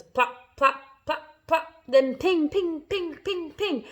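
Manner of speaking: staccato hailstone noises; imitated impacts. A narrator's reading. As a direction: sound out each hit separately. Imitating hailstones hitting the peach.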